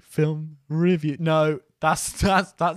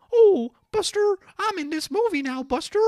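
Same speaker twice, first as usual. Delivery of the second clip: Daniel Craig voice; 5 dB louder than usual. Retold or imitated. Podcast host mocking an actor's film voice, high and hammy. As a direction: ham up a silly high impression of the actor. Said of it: "He did that voice where he goes like".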